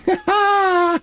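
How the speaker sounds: high, giddy burst of laughter. Real, not acted. Streamer finding his airship at last, giddy high laugh. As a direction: laugh with pure relief.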